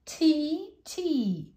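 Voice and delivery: put-on a child crying tea, tea; cried out. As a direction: call it out, rising then falling. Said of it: "cried Joe".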